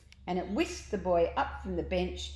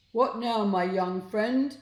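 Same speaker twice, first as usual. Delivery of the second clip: louder, slow, odd creature voice; sloctopus creature; 3 semitones higher, 7 dB louder, slower than usual. A narrator's reading. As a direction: ask like a friendly monster. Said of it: "asked the sloctopus".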